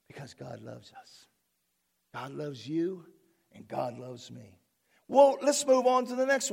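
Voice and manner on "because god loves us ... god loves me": tender aside. Real, not acted.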